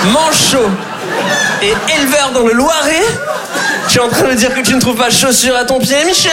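hammed-up disbelief, talking down to a farmer; Karine Le Marchand. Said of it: "elle est genre".

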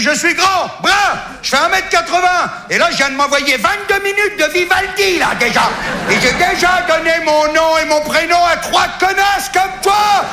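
hammed-up furious caller; mock rant. Ranting at the secretary.

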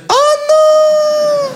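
very high, drawn-out mock wail; panicked inner voice. Stoned man spotting police talking to him, high comic panic. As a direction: squeal of dismay, stretched slowly. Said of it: "Et moi, je fais".

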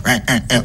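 quick imitated quacks; duck quacks. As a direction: quack like a duck being chased. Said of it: "qui court après un canard".

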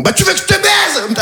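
sudden high-pitched yell; comically explosive shout, far from calm; mock-polite aggressive reply. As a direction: blurt it out as a high, shouted threat. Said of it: "très calmement, très poliment, il me répond".

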